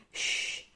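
long hissed "shh"; unvoiced shushing sound. A narrator's reading. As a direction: hush someone in a library. Library hushing after sneeze.

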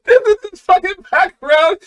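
very high, loud laughing disbelief; cracking up. Real, not acted. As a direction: squeal it out while really laughing. Cracking up at the song.

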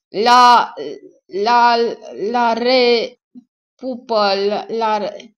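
loud, high, halting babble with gaps; loud stammering. Acted. Battered victim staggers towards the attacker, loud broken stammer. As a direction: bellow broken syllables, high and forced.

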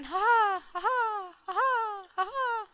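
high, put-on "ha ha"; repeated high laughs, each climbing a little; mock gloating laugh. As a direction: play at a triumphant cackle. Mock gloating after boast.

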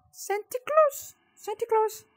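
high, drawn-out startled gasp of the name; gasping surprise. Real, not acted. Player spotting Santa in the dark, high startled squeal. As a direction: squeal it in shock.

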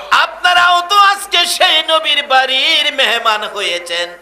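high, loud chanted sermon delivery; full-force preaching. Acted. Preacher telling the crowd of a son's journey, full-force preaching. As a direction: open on long held cries, then keep pressing the crowd loudly.

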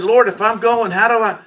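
man crying out to God, pleading and loud; pleading prayer. Retold or imitated. Desperate prayer recalled.

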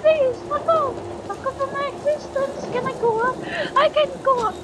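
very high, frightened cries; panicked squealing. Real, not acted. Player fleeing darkness and begging the threat off, high panicked squeal.

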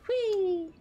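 high joyful whee; excited squeal. Real, not acted. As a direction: squeal with delight. Playful cheer.

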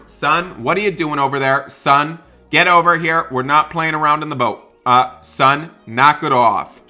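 loud stern calls across the room; calling and scolding son. Pretend. Parent calling son away from trouble on the ship, loud stern calls. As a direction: shout him back over, firm and loud.

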